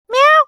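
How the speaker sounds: squeaky rising mew of a tiny kitten; high kitten meow. Acted. Narrator mimics a kitten mewing at the skeletons. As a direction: mew like a desperate little kitten. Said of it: "mewing at them in desperation".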